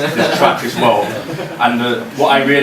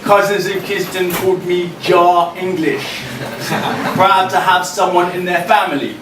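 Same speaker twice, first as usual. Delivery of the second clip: raised, ringing performance poem voice; higher and louder performance reading; spoken word poem; 7 semitones higher, 3 dB louder than usual. Acted. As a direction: deliver each line to the room like a slam poem.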